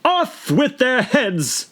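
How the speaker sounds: high, very slow royal order; imperious queen. A narrator's reading. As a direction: pronounce the death sentence.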